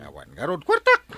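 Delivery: exasperated outburst. Acted. Wife exasperated about money.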